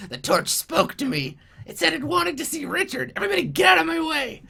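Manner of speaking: very high goblin, building to a hurried shout; self-important goblin. Acted. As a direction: announce it squeakily, then order everyone aside. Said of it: "he's, he's like".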